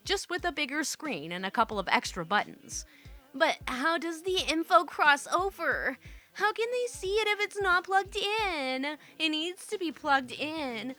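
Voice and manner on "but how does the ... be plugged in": high-pitched, fretful string of questions; whiny baffled nurses. Acted. Confused day-shift nurses questioning the monitor, high mock complaint. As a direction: pitch it up, clueless and demanding.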